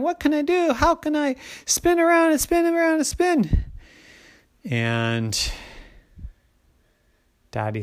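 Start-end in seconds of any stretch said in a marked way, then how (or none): 0.0-3.6 put-on high-pitched little-girl complaint; whining child. A narrator's reading. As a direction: whine it pleadingly, pitched way up. Bored child whines.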